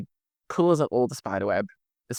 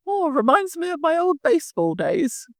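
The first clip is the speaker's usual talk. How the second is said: Mrs Claus voice. Acted. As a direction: play a fretful old Mrs Claus, much higher. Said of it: "she just goes".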